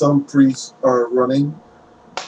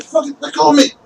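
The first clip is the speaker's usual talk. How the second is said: high-pitched struggling voice; protesting captive; 12 semitones higher, faster than usual. Acted. Necromancer to the crowd grabbing him, high protesting voice. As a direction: complain in a much higher voice.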